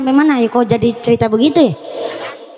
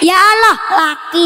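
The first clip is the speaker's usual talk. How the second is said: pleading cry; 6 semitones higher than usual. Retold or imitated. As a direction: cry it out to God. Woman pleading for a husband.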